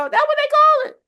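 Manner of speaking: squealed out very high and loud; preaching peak. Real, not acted. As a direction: hit the answer like a shout from the pulpit. Answers her own preaching question.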